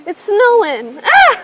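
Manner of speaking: excited shout. Real, not acted. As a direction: shout with delight at the snow. Delighted by fake snow.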